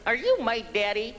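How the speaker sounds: high little-girl impression; Shirley Temple. Comic walks into a leather bar, mock little-girl voice. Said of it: "I sort of feel like Shirley Temple".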